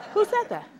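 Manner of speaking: high startled cry of "Who said that?"; a sudden high "Who said that?" to an empty room. Acted. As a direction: jump at a voice out of nowhere with "Who said that?". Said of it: "you say".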